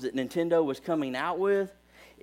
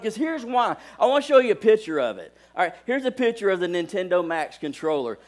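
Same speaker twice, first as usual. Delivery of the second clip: ordinary talk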